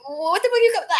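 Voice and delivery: high-pitched stunned disbelief; shocked outburst. Retold or imitated. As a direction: blurt it in confusion. Retelling her shocked reaction.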